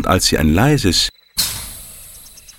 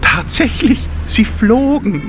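usual speaking voice

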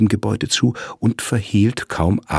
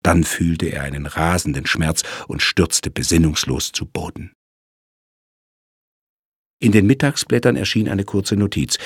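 plain reading voice

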